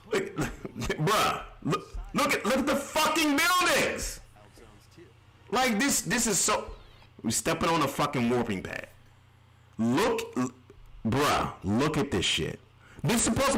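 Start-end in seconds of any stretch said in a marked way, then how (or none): none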